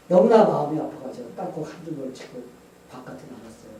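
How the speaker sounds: ordinary delivery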